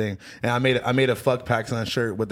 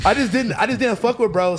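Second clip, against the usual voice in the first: laughing; 10 semitones higher, 5 dB louder than usual. Real, not acted. Still laughing after joke.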